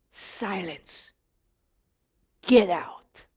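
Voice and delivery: very quiet, low order; hushed command. Acted. Jesus orders the demon out, hushed low command. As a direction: command almost under the breath, with a long pause. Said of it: "he said".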